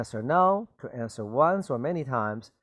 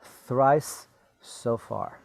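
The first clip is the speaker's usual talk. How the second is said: very slow, every word spaced out; slow model sentence; much slower than usual. Shown as a demonstration. Teacher slowly modelling the answer for students. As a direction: spell it out slowly for learners. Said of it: "So, yes, I have".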